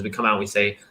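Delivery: ordinary talk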